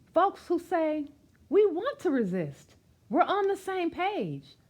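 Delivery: lively rhythmic read-aloud of a rhyming picture book; reading to children. Acted. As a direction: read it out warmly to a room of kids, bouncing the rhymes.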